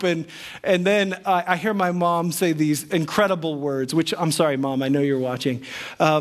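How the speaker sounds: ordinary delivery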